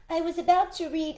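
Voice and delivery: a rabbit trying to explain. Acted. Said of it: "he began".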